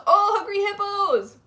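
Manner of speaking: high, slow delighted gush. Real, not acted. Streamer thrilled by a chat suggestion. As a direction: savour the memory out loud. Reacting to a game named in chat.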